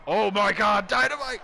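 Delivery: real astonished commentator shout; shocked excitement. Real, not acted. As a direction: burst out like you can't believe the move. Commentator reacts to power bomb.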